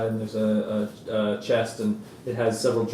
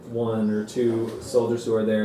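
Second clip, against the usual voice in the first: usual speaking voice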